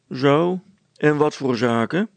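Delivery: loud, scornful question with lifted pitch; sneering. A narrator's reading. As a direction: sneer as you question them. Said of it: "vroeg de veldwachter smalend".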